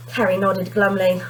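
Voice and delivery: plain reading voice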